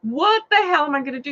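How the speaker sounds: quick, high, frantic question; retold panic. Driver facing spider on dashboard, retold panic.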